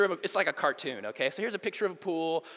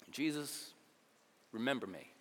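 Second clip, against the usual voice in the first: quiet plea; 5 semitones lower, 10 dB quieter than usual. Acted. Dying thief pleads quietly.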